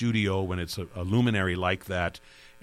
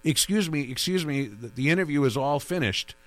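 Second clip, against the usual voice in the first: lightly mimicked stranger cutting in, pitched higher; imitated intruding voice; 6 semitones higher than usual. Host retelling a stranger barging into his interview, higher imitated interrupting voice. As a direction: put on a higher voice for the interrupting caller. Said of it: "I hear this alien voice saying".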